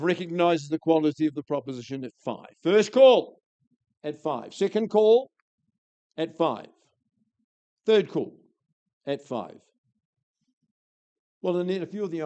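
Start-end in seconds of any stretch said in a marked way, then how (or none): 2.6-4.5 loud auction call. Real, not acted. Auctioneer calling the room to bid, loud. 4.5-6.7 Second call belted, high and loud with a rise; loud auction call. Real, not acted. Auctioneer pressing the room for a bid, loud. 7.9-9.6 a firm last call; loud auction call. Real, not acted. Auctioneer giving the final warning, loud.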